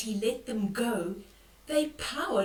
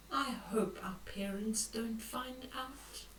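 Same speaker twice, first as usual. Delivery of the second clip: worried whisper; 7 dB quieter than usual. Acted. Boy whispering his fear about parents finding out.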